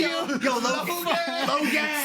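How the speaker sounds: high, slow, drawn-out call of a fan across a crowd. Retold or imitated. Imitating fans shouting his group's name at events, high call-out. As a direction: call it out like a fan spotting him.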